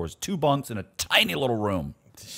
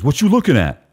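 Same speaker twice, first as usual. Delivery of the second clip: retold challenge; 4 semitones higher, 6 dB louder than usual. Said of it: "He goes".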